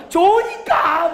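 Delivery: high drawn-out playful gush; acted delight, stretched and high; gushing sociable type. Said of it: "막 이러면서". (높고 길게 늘인 호들갑)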